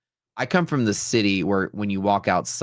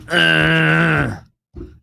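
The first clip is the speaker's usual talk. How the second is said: loud, high drawn-out groan; mimicked groan; 8 semitones higher, 8 dB louder than usual. Streamer imitating a stranger at his door, groaning. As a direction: imitate someone moaning at the door at night. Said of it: "somebody in the middle of the night was like".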